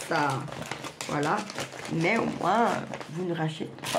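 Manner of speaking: usual speaking voice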